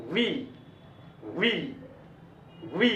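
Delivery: वी drawn out on a long ee; demonstrated V sound. Shown as a demonstration. Teacher models the letter V for learners, clear वी. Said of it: "अब तब बोलना चाहिए".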